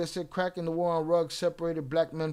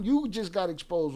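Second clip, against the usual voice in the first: ordinary talk, nothing marked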